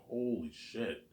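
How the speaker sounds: drawn-out shocked exclamation; slow stunned disbelief; slow stunned holy shit. Retold or imitated. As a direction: let it sink in slowly. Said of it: "I'm just like".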